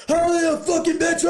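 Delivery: mock screaming yell. Shown as a demonstration. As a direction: scream it high to show how unclear yelling is. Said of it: "If you've ever tried to yell and scream".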